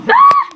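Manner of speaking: short, very high yelp; startled scream. Acted. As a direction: scream in sudden mock fright. Startled scream at tornado joke.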